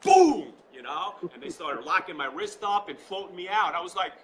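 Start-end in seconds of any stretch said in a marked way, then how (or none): 0.0-0.5 high, explosive "boom"; loud exclamation. Retold or imitated. Telling the host of coming off his feet, an explosive boom. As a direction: burst out loud like a sudden impact. Imitated impact in retold story.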